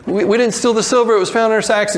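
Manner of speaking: high, hurried mock pleading; anxious protest. Brothers explain the found silver to Joseph, anxious protest.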